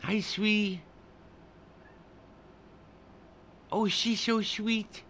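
high, sweet pet-talk voice; cooing to a cat. Real, not acted. Greeting the cat.

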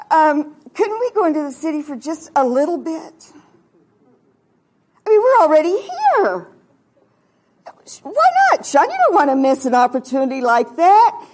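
high, insistent pleading with a husband; hammed-up wifely wheedling. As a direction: coax him, rising to a loud high plea. Acted wheedling of her husband.

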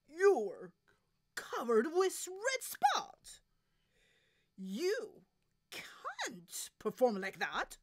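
loud, emphatic scolding; exasperated director. Acted. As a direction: project dismay across the stage. Director scolds spotted singer.

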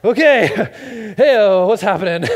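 loud, high, laughing confusion; flustered dad jolted awake; startled and flustered. Retold or imitated.

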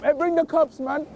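ordinary talk, nothing marked

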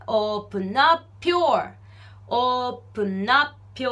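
slow "open up your" with the P of "up" joined to "your"; linked open up your. Shown as a demonstration. Teacher shows the P linking into "your". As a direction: glue "up" onto "your" as "apjo". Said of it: "アップのプとyourがくっついて".

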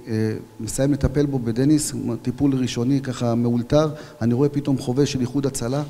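normal talking voice

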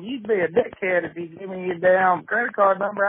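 ordinary delivery

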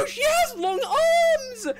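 high, slow, drawn-out cry of alarm; alarmed wail. Real, not acted. Player reacting to the monster's arms, wailing. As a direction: wail it in horror.

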